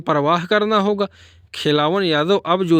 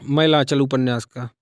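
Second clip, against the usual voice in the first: ordinary delivery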